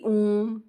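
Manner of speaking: ओम stretched long and slow, three beats; drawn-out Om. Shown as a demonstration. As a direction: hold the vowel of ओम for three counts. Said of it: "time लग रहा है ना इसमें बोलने में".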